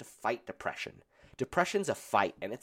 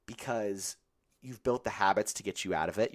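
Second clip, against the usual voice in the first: normal talking voice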